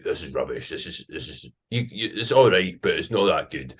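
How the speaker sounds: put-on grudging verdict in a Scottish accent; Scottish online critics. Commenters judging the accent video, dismissive Scottish voice. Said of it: "mostly people being like".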